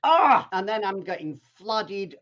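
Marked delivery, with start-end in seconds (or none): 0.0-0.5 a sudden loud, high retold yelp; half-playful cry of alarm; mock cry of dismay. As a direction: yelp as the chain snaps on mile one. Chain snaps, retold.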